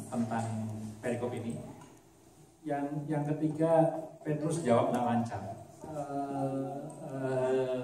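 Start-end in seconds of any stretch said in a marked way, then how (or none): 5.9-7.8 soft, faltering hesitation; a hint of Peter stumbling, close to tears; hesitant sad. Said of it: "ya sedih dia, mungkin mau nangis".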